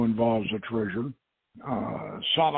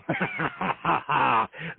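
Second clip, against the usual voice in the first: a knowing, gloating chuckle; villain's chuckle; 3 semitones higher than usual. Acted. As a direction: let the colonel chuckle at the rival's mistake. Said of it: "Renda began to chuckle".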